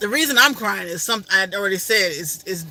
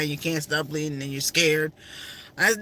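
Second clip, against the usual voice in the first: ordinary delivery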